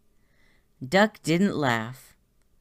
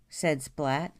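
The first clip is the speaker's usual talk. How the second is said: usual speaking voice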